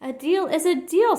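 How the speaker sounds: high, loud snap; snapping. A narrator's reading. Quuggerbug refusing Horton, a high loud snap. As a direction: cut him off sharply. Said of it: "snapped the bug".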